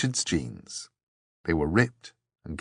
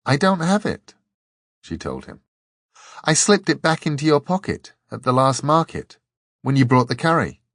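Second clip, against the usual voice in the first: put-on higher, girlish Dor; young woman's voice, pitched high; Dor's voice; 7 semitones higher, 4 dB louder than usual. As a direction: play the small pixie-like Dor, matter-of-fact. Dor confesses to Richard.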